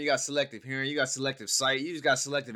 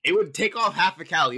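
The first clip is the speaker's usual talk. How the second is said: loud, higher-pitched objection; incredulous retort; 6 semitones higher, 8 dB louder than usual. Real, not acted. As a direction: fire back loudly in disbelief. Incredulous correction of the other speaker.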